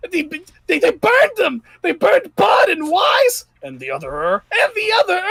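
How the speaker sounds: high, shrill panicked goblin yell; panicked hobgoblin shout. Acted. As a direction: scream it in panic, voice cracking. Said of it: "one hobgoblin who's like".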